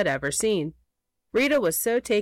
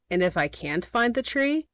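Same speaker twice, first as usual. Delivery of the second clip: ordinary delivery, nothing marked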